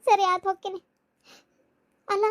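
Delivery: ordinary delivery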